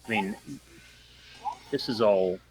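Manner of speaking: ordinary delivery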